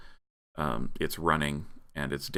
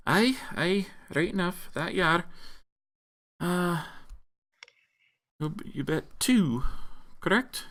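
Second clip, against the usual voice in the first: high-pitched bard voice; put-on high voice, checking a debt; tavern bard character; 12 semitones higher than usual. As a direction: play the bard in a much higher voice.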